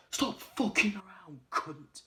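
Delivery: sudden loud, high, drawn-out outburst; acted-out furious yell; angry shout. Raymond to the guys holding the phone, angry shout.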